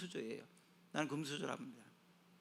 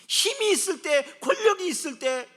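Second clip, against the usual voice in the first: forceful preaching; 12 semitones higher, 13 dB louder than usual. Acted. Preacher contrasting power with weakness, loud and forceful. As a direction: hammer out both phrases.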